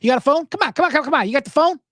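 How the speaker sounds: high, urgent movie-character impression; high-pitched, pushy mock outrage; frantic movie catchphrase. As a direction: imitate a worked-up guy demanding the phone. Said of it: "He goes".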